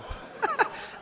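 squealing breathy laugh; high laugh. Real, not acted. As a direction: burst out in a high giggle.

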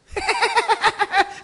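short high loud cackle; hyena laughter. Acted. Preacher mimics hyenas to the audience, cackling.

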